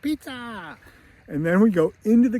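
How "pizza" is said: high, softened mock kid cheer; Kevin. Kevin storms downstairs after pizza, high mock holler. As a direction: imitate an excited little boy's holler without the volume. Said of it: "followed by Kevin".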